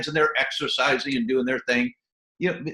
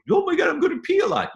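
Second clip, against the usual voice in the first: high, fast mock panic; high-pitched quick mock dismay; mock alarmed squeal; faster than usual. Voicing an alarmed listener.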